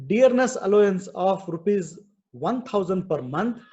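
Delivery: normal talking voice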